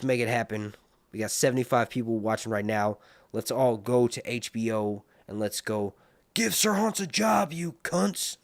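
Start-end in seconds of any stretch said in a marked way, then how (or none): 6.3-8.4 mock demand. Mock chant at HBO.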